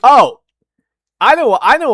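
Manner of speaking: sudden loud high "Oh!"; a startled burst of realization; battle yell. Real, not acted. Sudden realisation mid-video.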